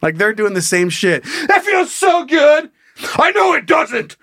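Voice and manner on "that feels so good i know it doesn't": very high, hammed-up screamed moans; mock screaming. Mimicking a woman putting on a show in bed, mock screaming. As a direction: shriek it in a fake falsetto. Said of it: "they're screaming too".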